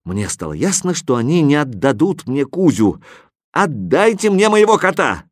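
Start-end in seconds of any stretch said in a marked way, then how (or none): 3.5-5.2 demanding. A narrator's reading. Hero demands cat.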